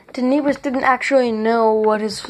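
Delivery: plain reading voice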